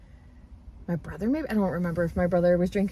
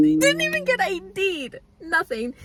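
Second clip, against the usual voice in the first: high, incredulous repeat after laughing; laughing disbelief; 4 semitones higher, 4 dB louder than usual. Real, not acted. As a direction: repeat it, still cracking up.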